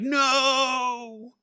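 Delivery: long, high, drawn-out "no"; mock anguished cry. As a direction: melodramatic wail of horror, hammed up. Acting man seeing brother killed.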